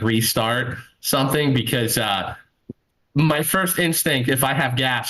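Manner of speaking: ordinary delivery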